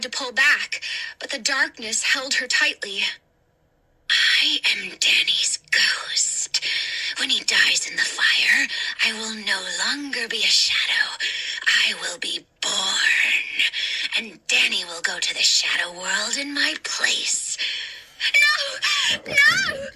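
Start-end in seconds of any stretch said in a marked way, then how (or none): 4.1-17.6 ghostly whisper. Acted. The ghost telling Hannah Danny will die, menacing whisper. 18.4-19.9 very high terrified shriek. Acted. Hannah refusing the ghost's plan, terrified shriek. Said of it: "Hannah shrieked".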